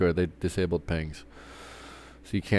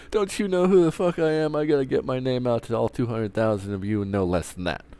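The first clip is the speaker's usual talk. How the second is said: much higher, hammed-up diva voice; mock self-important celebrity; 6 semitones higher than usual. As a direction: whine it like an offended star. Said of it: "It's like".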